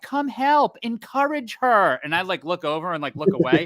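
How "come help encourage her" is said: high, slow coaxing nurse voice; urging nurses. Retold or imitated. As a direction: play the nurses calling him over, pitched up and drawn out. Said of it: "the nurses are like".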